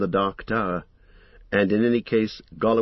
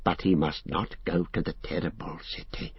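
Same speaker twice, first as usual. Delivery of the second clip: whisper; 4 semitones lower, 6 dB quieter than usual. A narrator's reading. Gollum's secret warning.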